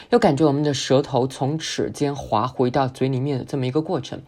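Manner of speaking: normal talking voice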